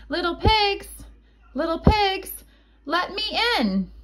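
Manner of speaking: high sing-song call, little pigs; calling at the door. Acted.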